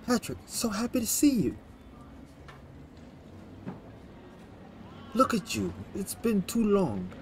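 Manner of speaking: high-pitched, delighted Italian greeting; Italian accent. Acted. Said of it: "That's my Italian accent, I guess".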